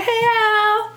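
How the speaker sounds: imitating a high, cheery hello; upbeat greeting. Imitating her friend's cheery hello on the phone, unaware of the news. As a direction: chirp it like nothing's wrong. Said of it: "your upbeatness".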